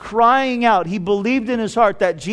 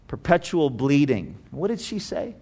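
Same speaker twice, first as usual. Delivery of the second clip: normal talking voice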